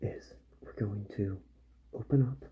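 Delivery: usual speaking voice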